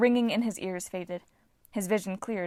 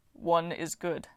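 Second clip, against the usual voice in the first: Titan voice; 3 semitones lower, slower than usual. A narrator's reading. Voices Bob.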